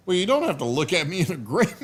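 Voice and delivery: laughing. Real, not acted. Laughs at dog's look.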